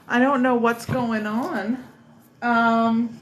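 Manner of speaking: ordinary delivery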